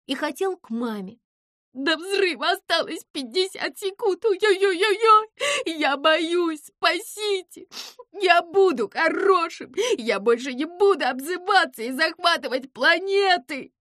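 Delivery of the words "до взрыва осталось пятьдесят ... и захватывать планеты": high, loud, whimpering wail; tearful, high-pitched begging; bitter crying. A narrator's reading. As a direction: plead like a little mouse wanting mama. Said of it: "заплакал так горько, будто был маленьким мышонком".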